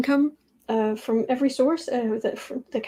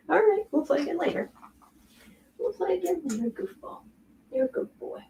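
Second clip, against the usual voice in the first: soft, higher cooing to the dog; 7 semitones higher, 6 dB quieter than usual. Real, not acted. Owner soothing her dog, high soft coo. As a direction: coo gently at the pup.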